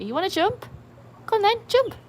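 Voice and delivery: high, loud sing-song coaxing of the dog. Real, not acted. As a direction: coax the dog up, bright and high. Owner coaxing dog to jump.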